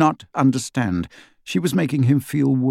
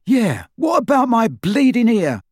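put-on higher, joking young man; cheeky young man; 11 semitones higher, 5 dB louder than usual. A narrator's reading. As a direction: toss it off as a cheeky joke. George voiced, joking.

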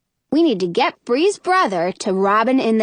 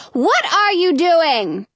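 slow, higher-pitched holler; mother's raised-voice demand; queen hollering; 6 semitones higher, slower than usual. A narrator's reading. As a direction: holler it across to another room, drawn out. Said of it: "the queen hollers".

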